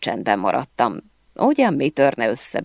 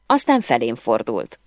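plain reading voice

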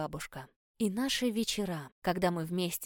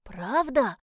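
put-on higher, louder eager child voice; hopeful boy; 4 semitones higher, 8 dB louder than usual. A narrator's reading. Little prince asks about promised fairy tale, hopeful. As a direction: ask with eager hope, like a child. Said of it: "с надеждой спросил Альфи".

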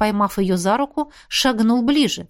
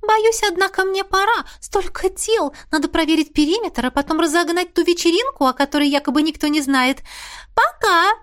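bubbly and coquettish farewell; cartoon princess; 8 semitones higher than usual. A narrator's reading. Ghost Missy flirty.

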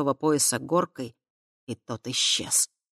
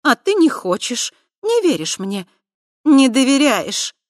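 higher, louder, hurt fury; angry reproach; 9 semitones higher, 9 dB louder than usual. A narrator's reading. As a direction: lash out, wounded and angry. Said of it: "В её голосе хлестнула злость".

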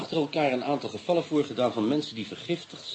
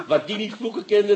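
laughing; 8 semitones higher than usual. Acted. Inspector recalls ship's parrot.

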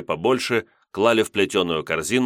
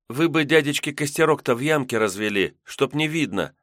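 put-on cautious, higher girl's plea; wary girl voice; 7 semitones higher than usual. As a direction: urge it like a nervous young girl. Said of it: "опасливо покрутив головой".

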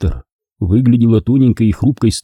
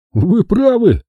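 higher, fawning agreement; obsequious; 12 semitones higher, slower than usual. Acted. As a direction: agree like a servile lackey. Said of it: "угодливо подтвердил Ламсден".

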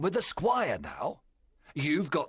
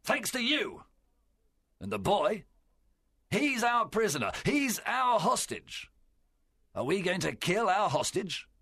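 high-pitched, emphatic argument; angry, strong voice; 11 semitones higher, 3 dB louder than usual. A narrator's reading. Silver argues for sparing the hostage, strong and angry. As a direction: drive every point home to the crew. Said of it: "his voice was strong".

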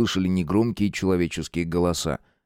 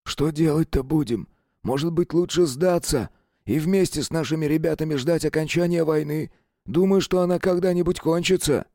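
higher, anxious sergeant voice; worried sergeant; 7 semitones higher than usual. A narrator's reading. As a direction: ask for orders, half giving up. Sergeant asks whispering. (встревоженный сержант)

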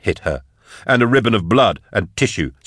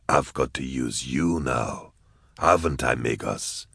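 low taunting; 6 semitones lower, 8 dB quieter than usual. A narrator's reading. Goblika taunting the caged Sark.

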